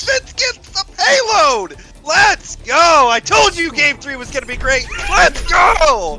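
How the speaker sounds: high loud victory yelling; cheering shout. Real, not acted. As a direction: shout with joy. Team wins map, cheering.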